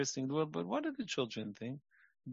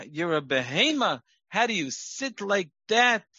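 mocking harsh spouse; 6 semitones higher, 7 dB louder than usual. Spouse berating child, acted.

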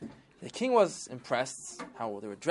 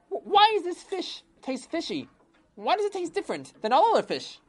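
high complaining king voice; a high royal whine of complaint; king grilling the cook in a high voice; indignant king; 4 dB louder than usual. Acted.

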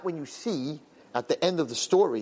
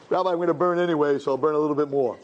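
lightly voiced sinner; 3 semitones higher than usual. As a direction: shrug it off to the rabbi, only lightly acted. The sinner answers the satan.

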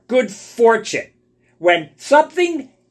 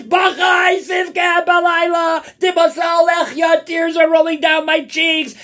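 wailing lament; 7 semitones higher than usual. Acted. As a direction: wail it like a prophet weeping over the ruins. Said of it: "He's, he's bawling his eyes out".

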